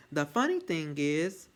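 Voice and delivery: usual speaking voice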